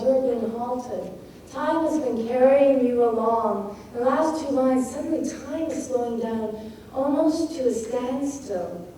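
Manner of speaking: usual speaking voice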